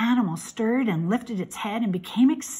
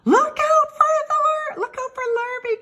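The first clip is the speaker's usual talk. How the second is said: high, loud alarm call; drawn, high-pitched holler; cows calling a warning; 5 dB louder than usual. A narrator's reading. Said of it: "called the cows".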